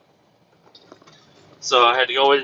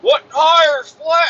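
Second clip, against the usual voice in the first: high, loud drawn-out holler; retold yell; 12 semitones higher, 4 dB louder, much slower than usual. Another guy in the shop to the driver, retold yell. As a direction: imitate a guy yelling across the shop. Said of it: "another guy yelling at me".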